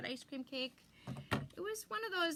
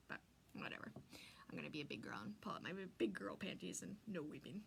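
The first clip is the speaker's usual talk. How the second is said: 1 teary whisper; 7 semitones lower, 8 dB quieter than usual. Real, not acted. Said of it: "I'm gonna get all weepy on you".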